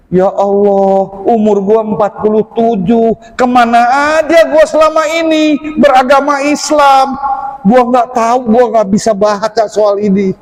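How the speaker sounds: high-pitched, acted-out dismay at oneself; exasperated lament. Retelling to friend the shock of the exam, exasperated lament. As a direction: wail mildly in self-reproach, voice pitched well up. Acts out despair at unreadable exam.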